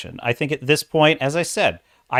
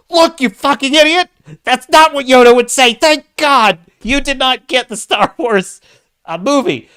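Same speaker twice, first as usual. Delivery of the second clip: furious mock outburst, much higher and louder; blew his stack; 12 semitones higher, 7 dB louder than usual. [name] scolding the fired writer, a high, loud, furious outburst. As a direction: blow your stack at the hack writer. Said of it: "[name] just blew his stack".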